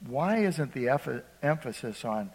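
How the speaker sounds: ordinary talk, nothing marked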